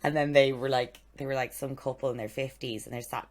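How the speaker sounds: ordinary talk, nothing marked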